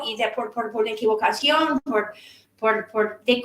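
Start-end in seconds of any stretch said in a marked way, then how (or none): none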